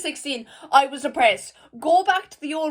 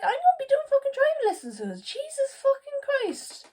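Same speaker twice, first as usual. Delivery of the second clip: giddy high-pitched disbelief; excited disbelief; 12 semitones higher than usual. Real, not acted. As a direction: squeal it like you can't believe it. Giddy disbelief about driving lessons.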